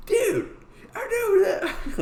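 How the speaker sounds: very high, loud hammed-up fan voice; mock excited squeal. Bartender realizing he knows the comic, exaggerated excitement. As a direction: squeal it as an over-the-top impression, breaking into laughter.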